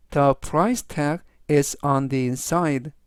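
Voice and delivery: very slow, each word separated; slow, clear reading. Shown as a demonstration. Teacher slowly reads the sentence three times for students. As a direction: read slowly so students can follow. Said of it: "제가 역시 천천히 세 번씩 읽어드리고요".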